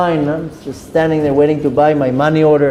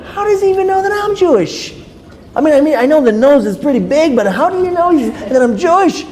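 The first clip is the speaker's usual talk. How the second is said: high-pitched bewildered questioning; acting out baffled astonishment; incredulous; 11 semitones higher than usual. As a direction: puzzle it out in comic disbelief. Retold bewildered thought about the stranger.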